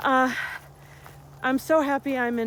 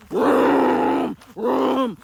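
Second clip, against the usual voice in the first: loud drawn-out mocking vroom vroom, slow; mocking the rider's engine noise; engine vroom imitation; 7 dB louder than usual. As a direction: imitate a revving engine with scorn. Mocking the four-wheeler revving engine.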